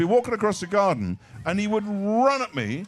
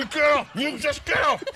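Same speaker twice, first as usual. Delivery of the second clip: panicked shout; 8 semitones higher than usual. Retold or imitated. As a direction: yell at the chasing cockerel. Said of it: "I go".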